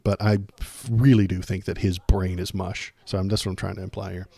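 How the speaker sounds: ordinary talk, nothing marked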